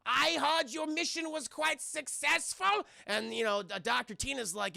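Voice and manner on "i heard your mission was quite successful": high, slow, hammed-up German accent; over-the-top German accent, drawn out. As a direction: play the German doctor on a TV screen. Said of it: "very stereotypical German accent".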